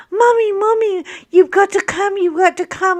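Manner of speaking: high, urgent retold yelling; imitated child's yell. Mother retelling her son's call for help, imitated urgent yell. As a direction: call loudly for mommy, mimicking a kid. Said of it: "he's yelling".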